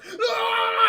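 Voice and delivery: a high, loud yelp of pain; mock shock scream. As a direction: yelp like a man who just got zapped. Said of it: "he goes".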